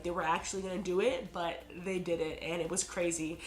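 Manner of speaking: ordinary delivery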